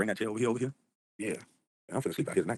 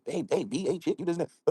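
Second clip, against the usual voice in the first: mock woman; 8 semitones higher, slower than usual. Mocking women arguing on panel.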